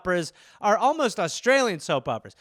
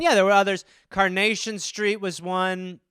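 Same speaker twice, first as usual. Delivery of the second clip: normal talking voice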